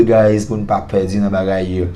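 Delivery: ordinary talk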